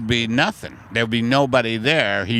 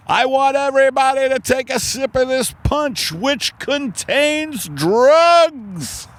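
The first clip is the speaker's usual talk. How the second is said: high, loud, drawn-out party hype man; 14 semitones higher, 4 dB louder, slower than usual. Pretend. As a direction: whip the orgy crowd into a frenzy. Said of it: "A hype man at an orgy".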